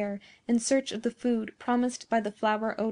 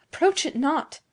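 higher, louder cry of terror; urgent, raised-voice warning; alarmed warning; 6 semitones higher, 5 dB louder than usual. A narrator's reading. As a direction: warn him off in alarm. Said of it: "observing with terror".

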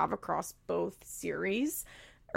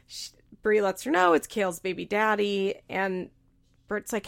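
normal talking voice

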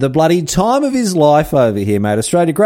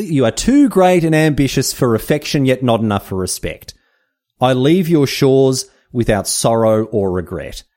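reading Darwin's farewell; 2 semitones higher than usual. Acted. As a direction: read it like a grand parting speech, slowing at the end. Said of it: "here we go".

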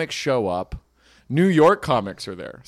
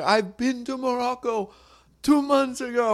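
much higher mock Russian-accented heckle; drunk Russian lady; 11 semitones higher than usual. Drunk Russian woman heckling the comic, mock Russian accent. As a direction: play the drunk Russian tourist interrupting.

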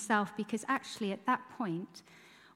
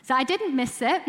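loud, amused self-mockery with a laugh; chuckling, triumphant admission; laughing at herself; 5 semitones higher, 8 dB louder than usual. Real, not acted. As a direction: laugh it off with relief. Joking about her Israel trip.

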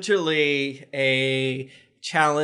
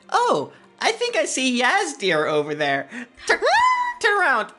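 pitched way up, sly and playful; a higher, teasing voice for Toby; high character voice; 9 semitones higher than usual. Acted.

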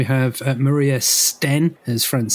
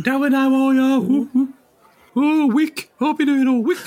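very high falsetto caricature; hammed-up, high-pitched character voice; comic put-on voice; 12 semitones higher than usual. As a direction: squeal it in a silly falsetto. Joking put-on voice after accent request.

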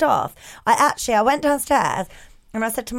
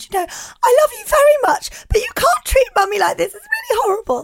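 high, loud tearful wail; fake-cry a loving reproach to a child; hammed-up wounded mother; pretend crying; 7 dB louder than usual. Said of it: "where I pretended to cry".

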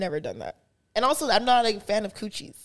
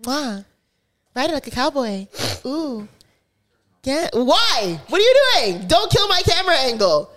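talking doll. Retold or imitated. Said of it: "your Pinky Doll impression".

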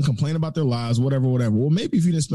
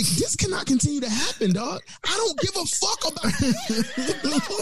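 higher, slow, laughing inner groan of disbelief; inner exasperation; 12 semitones higher, slower than usual. Retold or imitated. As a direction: drag it out, fed up but amused. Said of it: "in the back of my mind, I'm like".

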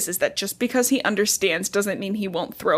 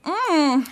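short high annoyed hum; a fussy little "mm" of irritation; irritated baby hum; 7 semitones higher than usual. Retold or imitated. Imitating a baby's irritated hum to her friend. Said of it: "a little sound of, like, irritation".

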